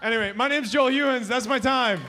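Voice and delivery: high-pitched, projected stage farewell; rousing sign-off. Real, not acted. Comic ending his set to the crowd, high rousing sign-off. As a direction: call it out high to close the set.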